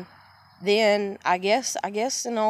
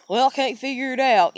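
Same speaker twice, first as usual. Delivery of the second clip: imitating dad; 5 semitones higher, 5 dB louder than usual. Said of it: "he'd be like".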